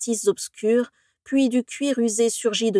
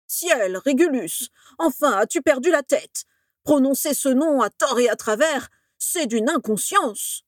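shocked professor, voice jumping higher; alarmed scolding; 5 semitones higher than usual. A narrator's reading. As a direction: scold in high-pitched alarm. (réprimande affolée, voix haut perchée)

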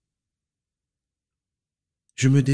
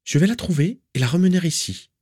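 determined; 9 semitones higher than usual. Acted. Narcissa determined.

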